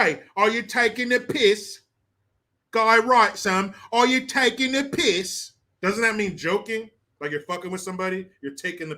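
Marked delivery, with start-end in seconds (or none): 0.0-1.8 higher-pitched British challenge; mock British accent. Trying British slang in accent. 3.9-5.5 high-pitched British questioning; mock British accent. Repeats British slang in accent.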